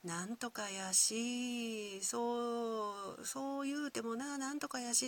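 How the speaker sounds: high, drawn-out Kyoto-dialect lilt; trailing Kyoto dialect. Shown as a demonstration. Kyoto native showing how sentences drift sideways, trailing Kyoto dialect. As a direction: let the Kyoto phrases flow on, endings stretched and left open. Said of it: "はっきり言わずに流していく".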